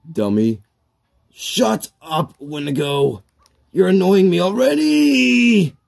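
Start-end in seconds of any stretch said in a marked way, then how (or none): 1.3-5.7 high-pitched whining protest, rising to a wail; exasperated, climbing complaint; annoyed. Acted. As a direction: snap at a heckler, building to a high wail. Snaps at the heckling friend.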